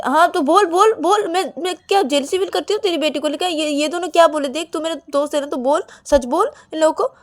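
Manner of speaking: high, loud urging; acted agitated appeal; insistent demand. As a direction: press a friend to back you up. Ananya urges Akash to back her.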